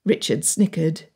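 ordinary delivery, nothing marked

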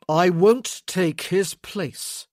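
loud, slow, forceful refusal; almost shouting. A narrator's reading. Man refusing to replace another, close to shouting. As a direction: hold back a shout, drawing out each word. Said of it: "he wasn't shouting, but it sounded like maybe he wanted to".

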